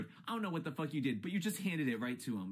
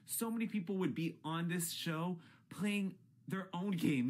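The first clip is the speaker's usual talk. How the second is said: usual speaking voice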